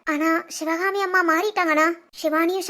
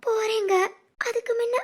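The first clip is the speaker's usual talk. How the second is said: subdued, crying plea for forgiveness; tearful apology. Acted. Wife to husband before leaving, tearful and humble. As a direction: beg forgiveness through tears.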